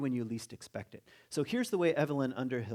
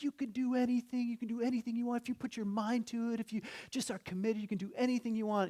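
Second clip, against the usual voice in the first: much higher, breathless-run champion voice; high, insistent post-game hype; hyped winning athlete; 10 semitones higher than usual. Acted. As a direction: gush like an athlete who just won. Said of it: "they're interviewing a guy".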